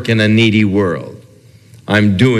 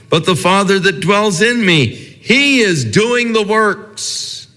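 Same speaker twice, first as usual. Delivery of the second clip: much higher, soaring preacher's cry; impassioned preaching; 7 semitones higher than usual. Acted. Preacher voicing Jesus to the congregation, soaring and impassioned. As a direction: lift each word of Jesus up high and ringing.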